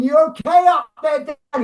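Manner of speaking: high, anxious call upward; calling up to the crane. Retold or imitated. Director calling up to the stuck cinematographer, high worried call. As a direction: shout up to someone stuck thirty meters up. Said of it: "I'm going".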